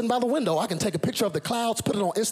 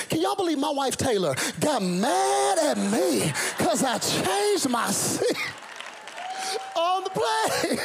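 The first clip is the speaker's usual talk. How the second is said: high drawn-out mock outrage, laughing; mock disbelief; 8 semitones higher, slower than usual. Preacher mocking his wife's anger, high slow disbelief. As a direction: stretch it out in fake shock, up high.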